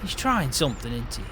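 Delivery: usual speaking voice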